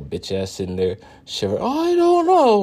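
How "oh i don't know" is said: much higher, louder whimpering mockery; high shaky put-on wimp voice; whiny mock coward. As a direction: mimic a scared timid wimp. Mocking a shivering coward.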